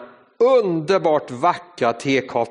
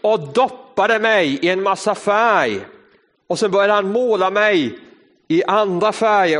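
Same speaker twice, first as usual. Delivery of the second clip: ordinary delivery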